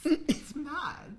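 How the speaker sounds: high mock-British verdict; best UK accent. Co-host judges the gossip, mock UK accent. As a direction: tut in a put-on British accent. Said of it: "give me your best U K accent".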